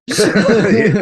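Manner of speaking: laughing yes, high and loud. Real, not acted. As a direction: say it through a burst of laughter. Laughs at pizza joke.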